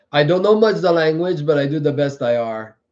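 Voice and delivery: put-on joking French-accented broken English; playful schoolkid French accent; mock French accent. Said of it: "I can make fun of the Frenchman".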